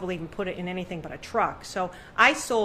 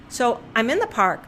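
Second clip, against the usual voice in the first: usual speaking voice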